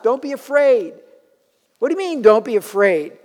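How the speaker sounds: high, disbelieving echo; preacher scoffing at the angel's words; incredulous. Acted. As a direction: toss the words back in disbelief.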